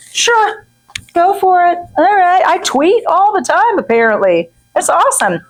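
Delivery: high, breezy go-ahead; sing-song carefree yes; mock carefree agreement. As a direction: shrug it off in a lilting high voice.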